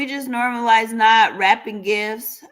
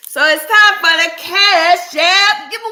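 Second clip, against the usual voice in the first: upbeat announcement; 8 semitones higher, 10 dB louder than usual. Acted. Announcing the giveaway again.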